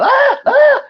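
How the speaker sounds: high, loud mock screams; imitated scream. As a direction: yelp out an imitation of panicked screaming. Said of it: "was literally just screaming".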